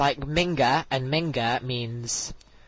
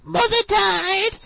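put-on very high, slow squeaky girl's voice; high, slow mock-reading voice; impression of Beverly; slower than usual. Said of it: "she speaks like this".

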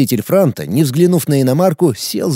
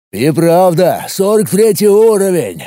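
admiring; 4 semitones higher, 3 dB louder than usual. A narrator's reading. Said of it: "восхитился Глыба".

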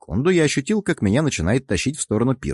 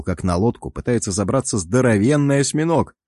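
ordinary delivery

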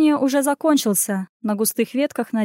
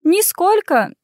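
laughing; 7 semitones higher, slower than usual. Acted. Caught faking, laughing.

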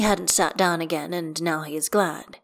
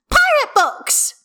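very high, loud, slow; excited child's yell; 8 dB louder, slower than usual. A narrator's reading. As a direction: holler it back like a little girl. Hestia shouts her answer.

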